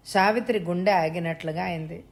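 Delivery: usual speaking voice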